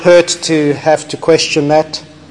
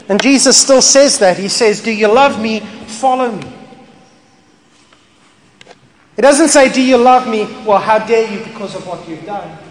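preaching at full force; 6 semitones higher, 4 dB louder than usual. Acted.

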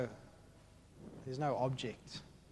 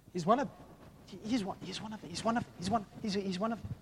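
lightly voiced girl, higher pitch; stammered, repeated pointing-out, pitched up; accusing servant girl; 6 semitones higher, faster than usual. Servant girl points Peter out across the fire, higher accusing voice.

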